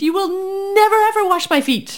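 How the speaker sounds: high, emphatic refusal on never, ever; a stubborn, adamant protest; protesting Peter. A narrator's reading. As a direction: refuse him outright, indignant. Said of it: "Peter protested".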